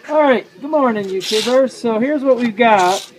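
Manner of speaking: muffled, through a face mask; talking through a mask. Real, not acted. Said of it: "I'm talking through a mask".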